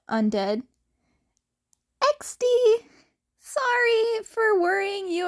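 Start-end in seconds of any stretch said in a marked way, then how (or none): 2.0-5.3 put-on high, bright boyish voice; a high, cheery boyish sorry; cheery apology. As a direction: apologize with a grin.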